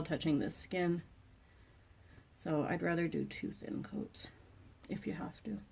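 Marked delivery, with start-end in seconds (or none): 3.3-5.6 soft unvoiced whisper. Real, not acted. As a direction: breathe it out under your breath. Quiet trailing aside.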